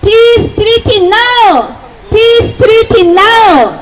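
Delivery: high, stretched-out protest shout; 구호 외치기. Real, not acted. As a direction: shout it slowly as a rally slogan. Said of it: "피스트리티 나우를 외쳐보겠습니다". (높고 길게 외치는 구호)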